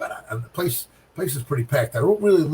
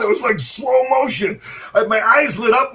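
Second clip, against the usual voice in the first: excited shout; 8 semitones higher than usual. Retold or imitated. Bowler reliving the pin falling, high excited shout. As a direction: shout it like you're reliving the miracle.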